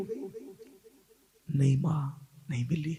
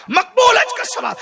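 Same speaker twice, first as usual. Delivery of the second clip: 1 shouted take; 6 dB louder than usual. Shown as a demonstration. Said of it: "repeats phrase, shouted take".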